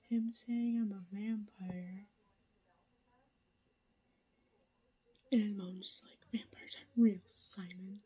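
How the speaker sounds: hushed late-night whisper to the camera; whispered update. Real, not acted. Vlogger whispering a reading update at night, hushed whisper. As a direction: keep it all breathy and hushed so nobody wakes.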